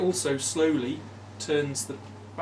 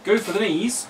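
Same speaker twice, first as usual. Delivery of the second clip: louder cheering yell; loud battle cry; 6 semitones higher, 8 dB louder than usual. Pretend. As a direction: shout it to the table. Repeating the game quote.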